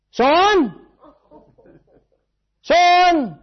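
loud, high shouts across the yard; calling out. Retold or imitated. Father calling his son back from the street, loud shouts. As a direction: call the boy's name from the porch. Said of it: "the dad came out and says".

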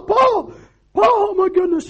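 very high-pitched mock wail; dismayed wife. Wife calling to her husband in the coffee shop, shrieky imitation. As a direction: cry out like a shocked wife. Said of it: "his wife happened to be sitting".

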